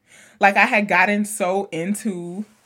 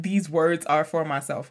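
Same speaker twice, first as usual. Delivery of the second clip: normal talking voice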